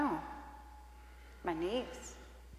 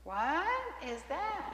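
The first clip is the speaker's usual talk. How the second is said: mock puzzled; 8 semitones higher, 4 dB quieter, slower than usual. Skit, wife feigns ignorance of prayer.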